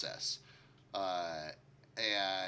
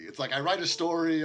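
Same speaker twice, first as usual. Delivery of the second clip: normal talking voice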